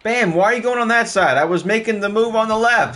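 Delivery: frustrated high-pitched protest; exasperated. Real, not acted. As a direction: demand an answer from Bam. Scolding teammate over a bad move.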